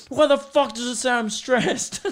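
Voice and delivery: high-pitched outraged yell, tipping into laughter; mock yelling. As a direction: imitate yourself yelling at the watch. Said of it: "I just start yelling at it".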